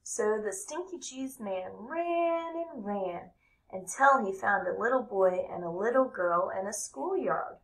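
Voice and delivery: ordinary delivery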